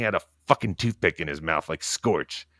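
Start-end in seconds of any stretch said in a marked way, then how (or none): none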